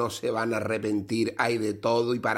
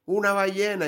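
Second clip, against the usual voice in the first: higher mock shout; excited mock shout; 9 semitones higher, slower than usual. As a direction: call out a whale from the pier.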